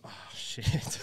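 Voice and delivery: whispered oh shit from the back; whispered dread from the back of the room. Retold or imitated. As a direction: breathe it in quiet horror. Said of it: "you sat at the back going".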